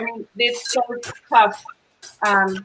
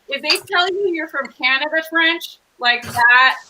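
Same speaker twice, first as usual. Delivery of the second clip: ordinary talk, nothing marked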